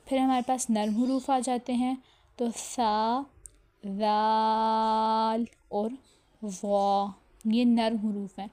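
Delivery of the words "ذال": ذال said soft and drawn out, the dh sound held gently; soft letter. Shown as a demonstration. Teacher showing students a soft letter, spoken gently and slowly. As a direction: soften and stretch ذال. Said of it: "ان کو ہم نے نرمی سے پڑھنا ہے".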